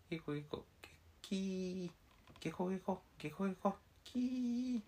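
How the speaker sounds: alternating monkey screeches and frog croaks; monkey and frog noises. Pretend. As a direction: act it out in monkey and frog sounds. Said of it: "猿はキーとしか言えない".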